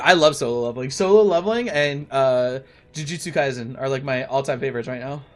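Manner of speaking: ordinary talk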